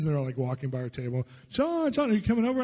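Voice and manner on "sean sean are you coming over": high, bright call across the room. Retold or imitated. Pastors hailing him as they pass his table, high calling out. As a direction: hail a friend from afar, high-pitched.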